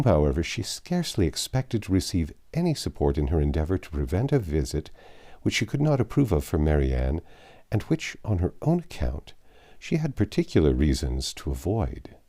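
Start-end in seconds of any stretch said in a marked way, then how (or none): none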